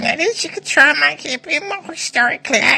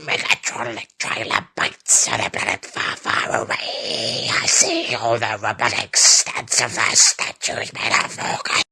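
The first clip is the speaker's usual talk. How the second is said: deep robot voice. Acted. Movie trailer villain voice.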